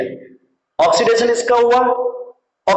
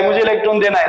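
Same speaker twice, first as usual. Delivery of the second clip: ordinary talk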